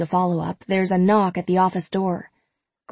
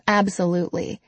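usual speaking voice

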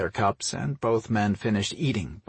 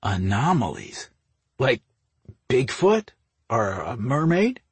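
disbelieving questions, rising sharply on Bigfoot; halting, puzzled disbelief; incredulous; 3 semitones higher than usual. A narrator's reading. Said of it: "with an incredulous expression".

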